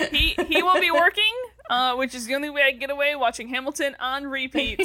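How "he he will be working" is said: laughing. Real, not acted.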